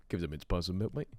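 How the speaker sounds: low, lightly voiced greedy Santa; mock the greedy Santa; cheeky Santa. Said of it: "he has the audacity to go".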